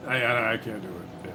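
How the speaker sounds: ordinary talk, nothing marked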